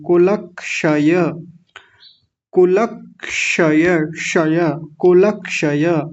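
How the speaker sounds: कुलक्षय slowly, with क्ष joined to the ल; don't stop on ल in कुलक्षय; slow pronunciation drill. Shown as a demonstration. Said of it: "इनको साथ में जोड़ लें".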